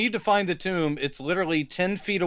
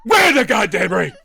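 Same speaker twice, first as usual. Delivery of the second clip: higher, loud mock yell; hammed-up furious shout; mock angry shout; 3 semitones higher, 6 dB louder than usual. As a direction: bark it like a raging hero. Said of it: "He's like".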